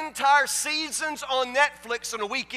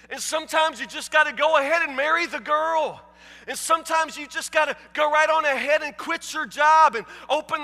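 full-force preaching; 3 semitones higher than usual. Real, not acted. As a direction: preach it to the rafters. Preaching builds to climax.

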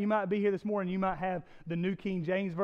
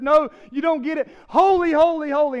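much louder, higher, correcting the other angel; no, you don't get it, louder still; insistent angelic shout; 9 semitones higher, 13 dB louder than usual. Acted.

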